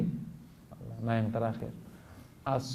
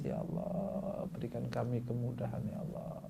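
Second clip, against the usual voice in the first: imitated tearful prayer; 8 dB quieter than usual. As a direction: act out a soft, weepy night prayer, understated. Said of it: "setiap malam sholat sampai nangis-nangis".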